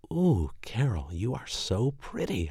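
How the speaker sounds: slow, high admiring coo; delighted exclaim. Acted. Boyfriend admiring her, cooing exclamation. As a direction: gush over how pretty she is. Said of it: "I exclaimed".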